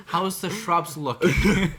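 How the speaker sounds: hammed-up suburban dad drawl; mock suburban dad. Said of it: "that's the suburban dad".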